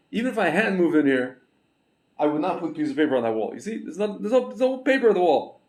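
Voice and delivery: high, laughing, excited teasing; amused excitement. Real, not acted. Host tells viewers his bare wall proves his point, laughing and high.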